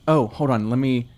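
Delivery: usual speaking voice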